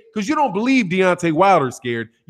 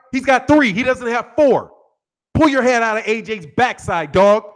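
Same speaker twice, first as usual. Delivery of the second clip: ordinary talk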